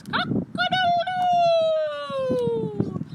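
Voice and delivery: high, loud, long-held crow; rooster crowing. Acted. Rooster making music with friends, long high crowing. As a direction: crow like a rooster, holding the last note. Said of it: "The rooster with his".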